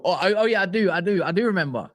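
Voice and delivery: ordinary talk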